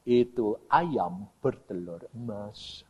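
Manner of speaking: slow, low, soft voice of God; God speaking. Retold or imitated. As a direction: intone it quietly and very slowly. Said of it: "Tuhan ini ngomong ini".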